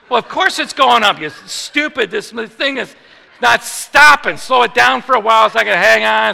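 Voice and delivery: loud, high-pitched exasperated rant; mad outburst. Retold or imitated. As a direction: yell your complaint at the doctor mid-run. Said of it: "Now I'm mad".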